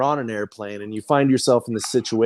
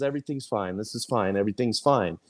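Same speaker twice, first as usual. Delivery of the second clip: softer, deadpan mock calm; meme dog in the fire; 4 semitones lower, 6 dB quieter than usual. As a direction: hush it out like the dog sitting in the fire, pretending all is well. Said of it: "I'm the dog in the fire that says".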